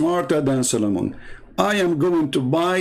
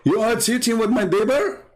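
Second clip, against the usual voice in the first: higher, laughing outraged question; mock shocked husband; 8 semitones higher than usual. Husband catching his cheating wife, higher and laughing. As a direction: play the betrayed husband half-laughing. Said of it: "he find his wife cheating".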